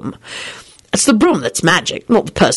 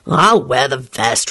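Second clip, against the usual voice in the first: cheerful; 5 semitones lower, slower than usual. Acted. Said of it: "replied Lupin cheerfully".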